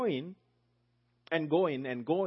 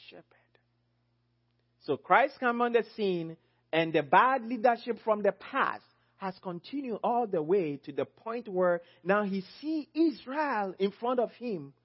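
normal talking voice

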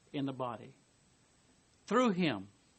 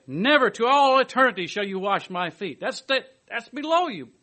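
loud, raised insistent no; emphatic refusal; 7 semitones higher, 9 dB louder than usual. Retold or imitated. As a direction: forbid it outright. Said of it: "his equally emphatic negative reply".

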